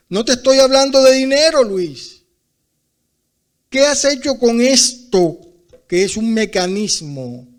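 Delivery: loud, high rebuke, retold voice of God; rebuking shout. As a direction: call him out hard and loud.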